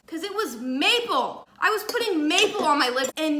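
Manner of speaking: horrified reveal of the flavor; shocked. Retold or imitated. Shock at wrong chapstick flavor.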